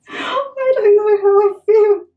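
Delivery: high, slow wail of doubt; distressed whine. Real, not acted. Vlogger fretting over her brushed-out hair, high whine. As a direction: draw it out high, near tears.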